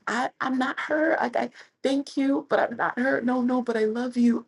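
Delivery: high, nervous polite refusal; flustered denial. Retold or imitated. Telling the Russian fan she isn't Serena, high and flustered. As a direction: squeak out a flustered no.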